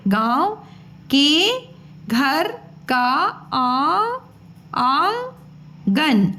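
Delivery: आंगन drawn out slowly, syllable by syllable; slow sounding out. Shown as a demonstration. Teacher sounding out आंगन for children, very slow and separated. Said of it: "उसको पढ़ा करो अलग से".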